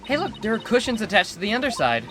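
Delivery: coaxing Trucy voice. Acted. Voices Trucy calling Apollo over.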